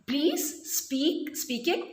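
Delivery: "Please, speak, speaking" one at a time, each with a long ee; E sound. Shown as a demonstration. Teacher showing the shared vowel, "Please, speak, speaking" with long ee. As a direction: list "Please, speak, speaking" separately to show the ee. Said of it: "E sound".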